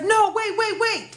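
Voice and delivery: high, loud imitation of panic; mock panicked pleading. Date begging once police are called, imitated high and panicky.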